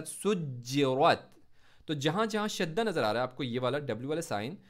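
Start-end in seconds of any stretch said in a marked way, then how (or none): 0.0-1.3 सुज्जिरत slow with a hard doubled ज्ज; stressed jeem. Shown as a demonstration. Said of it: "सू को जीम के साथ". (सुज्जिरत में जीम पर शद्दा)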